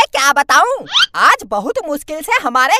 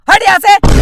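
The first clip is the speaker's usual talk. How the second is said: loud bellowed dismissal; exasperated shout; angry shooing shout; 3 semitones higher, 8 dB louder than usual. Acted. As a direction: yell at the beggar to clear off. Man chases beggar away.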